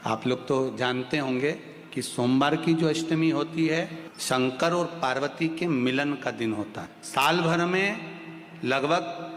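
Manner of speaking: soft, slow, measured teaching; hushed, unhurried preacher; calm devotional instruction. Real, not acted. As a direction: give ritual instructions quietly, with pauses between phrases.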